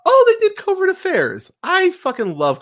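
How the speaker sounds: sudden high, loud burst of delight; excited. Real, not acted. Podcast host suddenly recognizing the show's creators, excited. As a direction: blurt it out, thrilled by the discovery.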